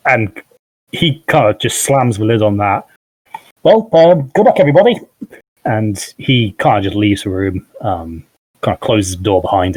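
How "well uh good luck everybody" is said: cheery farewell. Acted. Petlamir leaves the room.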